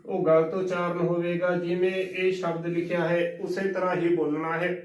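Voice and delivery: normal talking voice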